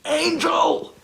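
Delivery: a high, shocked cry of the name; startled exclamation. Retold or imitated. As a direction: blurt it out, unable to believe it. Sudden surprise of seeing Angel.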